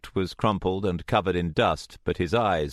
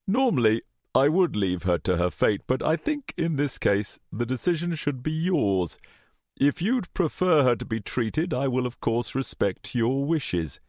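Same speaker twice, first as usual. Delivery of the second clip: higher-pitched, measured formal villain voice; lifted, insinuating politeness; smooth superior; 6 semitones higher than usual. A narrator's reading. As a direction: offer the choice with lofty courtesy. Smooth villain offers a choice.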